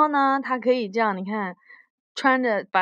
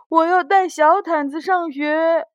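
put-on higher-pitched storybook child's demand; acted childlike insistence; insistent little boy; 5 semitones higher, 7 dB louder than usual. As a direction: voice a child standing his ground, pitched up. Child character insisting to parents.

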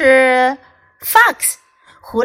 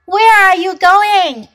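Bird voice; 8 semitones higher, 6 dB louder than usual. Acted. Bird voiced in read story.

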